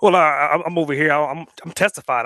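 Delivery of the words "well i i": usual speaking voice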